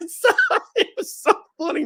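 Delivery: talking through real laughter; high laughing voice. Real, not acted. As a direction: giggle the words out. Laughing at own story.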